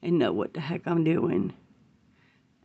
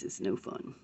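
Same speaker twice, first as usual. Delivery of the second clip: ordinary talk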